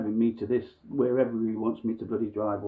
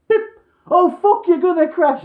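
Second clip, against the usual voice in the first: panicked yell; 12 dB louder than usual. Retold or imitated. Instructor panics, grabs wheel.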